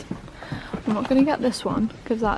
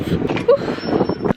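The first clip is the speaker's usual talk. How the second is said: relief whew; 7 dB louder than usual. Real, not acted. Helmet off, relief breath.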